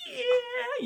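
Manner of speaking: high, drawn-out yeah; acted squeal of delight; giddy cheer. Winner celebrating with his girl, giddy cheer.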